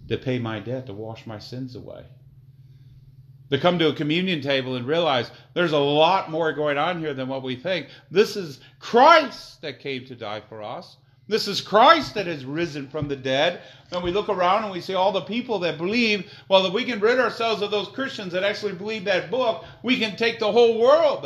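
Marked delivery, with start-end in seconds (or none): 3.5-13.6 preaching. Acted. Preaching at full force. 13.9-21.2 higher, louder mocking voice of the globalists. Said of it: "That's what the globalists say".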